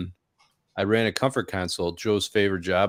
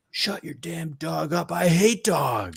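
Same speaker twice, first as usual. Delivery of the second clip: slow, much higher mock of a grumpy neighbor; 8 semitones higher, much slower than usual. Imitating the complaining neighbor for the podcast hosts, mocking and slow. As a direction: ham up the crank next door. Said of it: "one of the comments she said".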